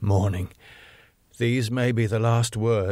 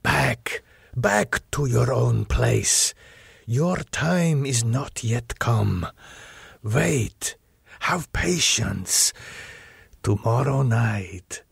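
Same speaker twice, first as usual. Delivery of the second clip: slow commanding count; slow, measured order to hold back; the Count's voice; slower than usual. Acted. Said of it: "I heard the voice of the Count".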